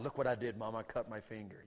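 ordinary talk, nothing marked

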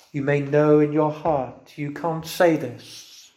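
normal talking voice

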